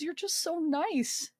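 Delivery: high, slow, tearful praise; trying not to cry. Retold or imitated. Nurse to an injured patient, imitated near-tears. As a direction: imitate someone welling up. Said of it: "trying not to cry".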